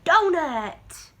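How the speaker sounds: spring the word on them; excited reveal. Shown as a demonstration. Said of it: "D, d, d".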